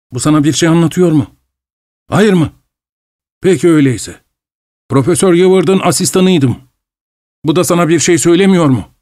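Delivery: high, restless voice of a fevered scientist; feverish Doctor Goodbody. Acted. Goodbody introduces himself to the traveller, high and feverish. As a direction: speak up high and jittery, half-mad.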